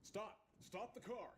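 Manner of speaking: high, urgent command; shouted warning. A narrator's reading. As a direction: call out to halt a moving car. Police officer halting runaway car.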